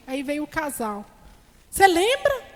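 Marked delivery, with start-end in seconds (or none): 1.7-2.4 lightly voiced eager question, higher; a touch of an eager church sister; imitating the sister. Sister pressing Flávia to remember, lightly voiced eager question.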